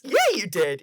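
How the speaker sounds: cheery mock Goku. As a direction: agree lightly like Goku. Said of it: "and he goes".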